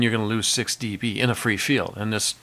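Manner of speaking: ordinary talk, nothing marked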